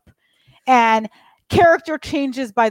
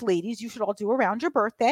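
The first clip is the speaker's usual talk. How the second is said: usual speaking voice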